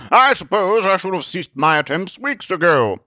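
high, stately, affected delivery; grandly rueful crusader; resigned Zealot. Acted. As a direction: concede with wounded dignity.

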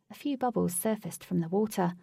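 plain reading voice

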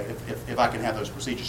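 halting, breathless imitation of the dying sister; stammering, breathless. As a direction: quietly stutter the words like she's drowning. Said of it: "She didn't say it like that".

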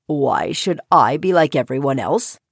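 low, nonchalant frog; deep, untroubled frog voice; shrugging frog. A narrator's reading. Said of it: "The frog shrugged".

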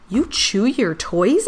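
put-on slow, incredulous kid voice; can't believe it; cat shocked that a dog chews toys; astonished question. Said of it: "Itty exclaimed".

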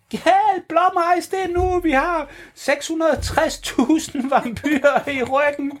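high breathless call for help; urgent plea. Acted. Bursting into the room, urgent high plea. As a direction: beg the bird to hurry, high. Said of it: "de bryder ind".

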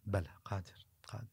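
whispered, barely audible yes; the sheikh whispering his reply to the drunkard. Retold or imitated. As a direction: breathe the answer out. Sheikh answers softly.

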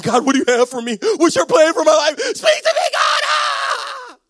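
building from loud demand to high scream; hammed-up frantic yelling, climbing to a shriek; desperate shouted plea. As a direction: cry out frantically to God. Acting out desperate demand to God.

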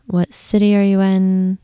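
usual speaking voice

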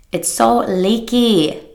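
"It's so leaky" stretched slow and whiny; drawn-out mock whine. Shown as a demonstration. Coach repeating "It's so leaky" in a slow mock whine. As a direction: drag out "leaky" like a sulky complaint.

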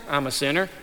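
soft, low humble admission; humble confession. Retold or imitated. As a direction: confess it quietly with a lifted end.

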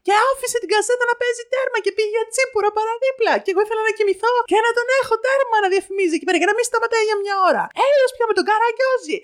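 very high-pitched, breathless-run tirade, acted out; furious ranting mother. Mother woken at midday berating the vendor, furious retold tirade. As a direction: rant without let-up until it bursts. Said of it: "με φωνή τώρα αυτά".

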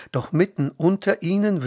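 ordinary delivery

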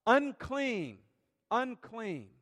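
acted leper's cry, each word falling away; warning shout. Lepers warning anybody coming close in the distance, shouted. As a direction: call it out loud and high. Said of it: "they had to shout".

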